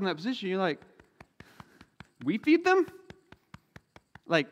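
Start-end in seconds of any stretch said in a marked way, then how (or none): 2.2-2.9 high, loud baffled question; can't believe what you just heard; incredulous disciples. Retold or imitated. Disciples asked to feed the five thousand, high incredulous question.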